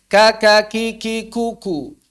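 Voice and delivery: ka ki ku each with light breath after k; syllable drill. Shown as a demonstration. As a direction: drill ka, ki, ku crisply. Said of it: "drilling syllables". (latihan ka ki ku)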